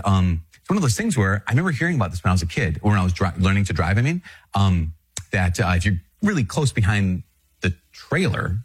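ordinary talk

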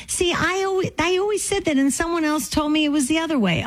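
high-pitched "told you so"; vindicated protest. Real, not acted. Host learns she had the town name right, vindicated high protest.

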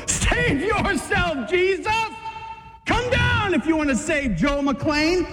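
a crowd's sneering shout; mocking shout. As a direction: yell up at him in mockery. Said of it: "As we stand at the foot and go".

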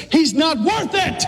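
sudden high shout; devil's outburst. Acted. Devil arguing Jesus out of saving a man, high shout. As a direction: burst out high in exasperation.

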